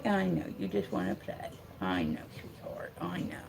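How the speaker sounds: soft, low aside to a pet; gentle, hushed sympathy; soothing. Real, not acted. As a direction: quietly console the puppy. Consoling the dog after its plea.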